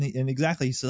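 usual speaking voice

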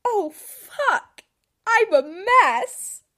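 loud, high, slowed wail; mortified outburst at own diary; flustered exclaim. Real, not acted. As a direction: cry it out half-laughing. Realizing crush, exclaims.

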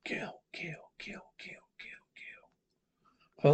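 eerie unvoiced whispered chant; ghostly whisper. The woman's voice urging Darrell to kill, ghostly whisper. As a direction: hiss the word kill like a phantom.

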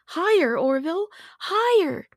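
put-on high, loud call of a thrilled old father; excited cry. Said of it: "Milton cried out excitedly".

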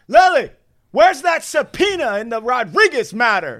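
high, bossy call to the assistant. Retold or imitated. Boss calling assistant about a subpoena, high bossy call. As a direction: ask for the file like a demanding boss.

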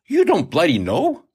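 can't believe the lad doesn't know; surprised disbelief. A narrator's reading. Captain astonished at the young sailor's ignorance, mock disbelief. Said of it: "nearly dropped his mug in surprise".

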